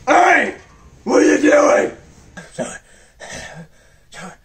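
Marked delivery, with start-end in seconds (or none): 0.1-2.0 startled shout. Acted. Catches someone in the act.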